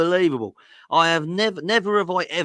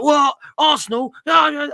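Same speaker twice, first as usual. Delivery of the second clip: high, loud mock shout; hammed-up outraged yell; mock outraged fans; 11 semitones higher, 7 dB louder than usual. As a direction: shout it like furious rival fans. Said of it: "They're like".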